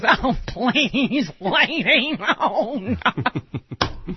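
far, laughing camp Paul Lynde put-down; hammed-up Paul Lynde line; imitated Paul Lynde. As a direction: sneer it with a giggle. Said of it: "And he goes".

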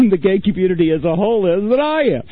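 breaking into real laughter mid-sentence; laughing. Real, not acted. Host laughs at himself before his listeners, laughing.

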